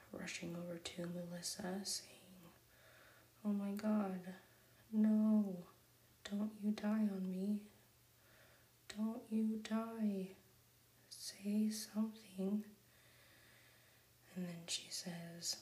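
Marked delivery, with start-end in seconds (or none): 3.4-12.6 panicked, pushed whisper-cry, louder; frantic pleading. Retold or imitated. Tina begging the collapsed cow not to die, frantic.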